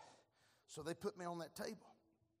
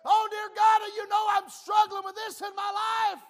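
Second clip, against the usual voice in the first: desperate pleading cries, much higher and louder; shouted prayer; 25 dB louder than usual. Retold or imitated. Preacher reliving his cries for help to God, loud pleading shout. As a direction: preach the prayer at full force, one plea after another.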